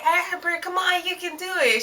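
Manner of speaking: high cheering to the sister; encouraging call. Retold or imitated. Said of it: "I was like".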